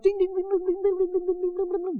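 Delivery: high, soft ding-a-ling on one pitch; rapid, light run of high dings; imitated little bell. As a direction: tinkle like a dog's collar bell. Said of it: "got a little bell on its neck".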